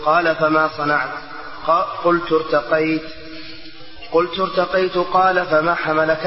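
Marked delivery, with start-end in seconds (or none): none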